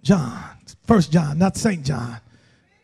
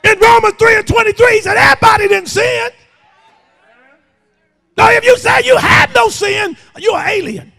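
emphatic verse, stretched word by word, then driving; full-force scripture preaching; 10 semitones higher, 4 dB louder than usual. Real, not acted. Preacher quoting First John on sin, forceful preaching. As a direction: hammer the verse at the congregation.

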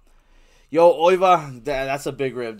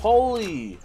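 high, loud cry of surprise; stunned exclamation; 10 semitones higher, 8 dB louder than usual. Real, not acted. Streamer reacting to the game, stunned exclamation. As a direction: burst out high, then let it fall.

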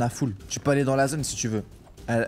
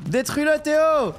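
urgent call; 11 semitones higher, slower than usual. Real, not acted. Calling out to teammate mid-fight.

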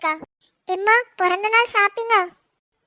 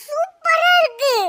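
delighted gush; 8 semitones higher, slower than usual. Acted. Cousin admires the dress.

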